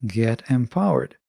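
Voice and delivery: normal talking voice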